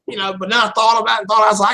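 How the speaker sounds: normal talking voice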